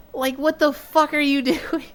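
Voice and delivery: high-pitched mock outrage breaking into laughter; exasperated squawk, laughing through it; imitated angry teammates. Mad teammate to a player who can't score, mock-angry.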